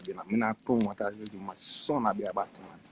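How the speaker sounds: usual speaking voice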